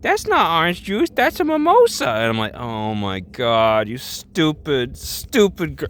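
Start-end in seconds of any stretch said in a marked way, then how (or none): 0.0-2.1 high-pitched indignant objection, climbing higher; mock someone correcting in a squeal; put-on high voice; Jojo. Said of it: "Jojo is like". 2.5-5.9 slow, drawn-out exasperation, rising in pitch; hammed-up exasperated reaction. Exasperated at Jojo's mistake.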